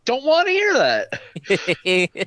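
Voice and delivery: drawn out through a laugh. Real, not acted. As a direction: laugh while teasing. Teasing friend, laughing.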